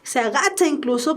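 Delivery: ordinary talk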